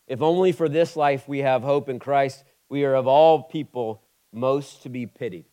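preacher reading scripture, rising in force. Acted. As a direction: read the passage with building weight.

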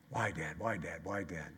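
low, soft, repeated mock whine; understated imitation of a child asking why; a hint of a nagging child. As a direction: lightly mimic a pestering kid. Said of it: "I look at Nancy and I go".

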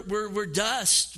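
usual speaking voice

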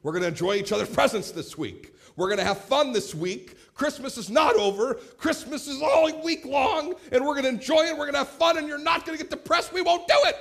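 high, loud shout refusing the gloom; father yelling at the family dinner table to keep the joy going. Retold or imitated. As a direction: bellow it to ban the post-Christmas blues. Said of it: "I yelled at him just like that".

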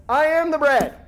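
loud, high, exasperated declaration. Acted. Jesus to the crowd, loud exasperated declaration. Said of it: "it's almost exasperated here".

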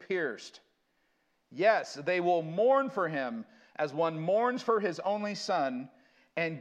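slow, loud, weighty reading of prophecy; solemn scripture reading. Acted. Preacher reads Zechariah to his congregation, slow and solemn. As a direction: proclaim each line slowly to the congregation.

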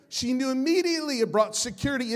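normal talking voice

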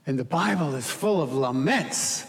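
normal talking voice

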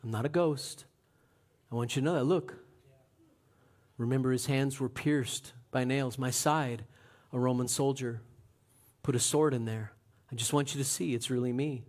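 soft, low, gentle reassurance; quietly convince them it's really him; reassuring Jesus. Retold or imitated. Jesus showing disciples his wounds, soft and tender. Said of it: "So he's like".

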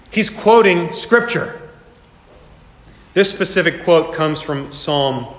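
ordinary talk

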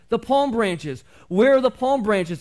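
loud, high, slow desperate shout; shouting donkey. A narrator's reading. The donkey shouts at the marketplace crowd, loud and high. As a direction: cry out for the missing palm branches. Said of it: "he shouted".

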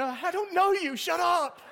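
high, loud, laughing mock snap at the crowd; grumpy runner. Pastor mimics an exhausted runner telling cheering fans to go away, high and laughing. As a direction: play the worn-out runner shooing off cheering strangers.

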